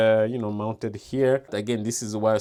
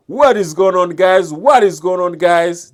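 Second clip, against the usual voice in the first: much higher, much louder shout; screaming; 8 semitones higher, 10 dB louder than usual. Shown as a demonstration. Mic reviewer screaming to test clipping, full shout. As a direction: yell the greeting to make the mic clip. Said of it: "This is me screaming".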